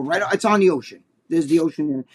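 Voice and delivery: ordinary talk, nothing marked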